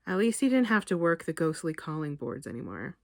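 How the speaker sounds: plain reading voice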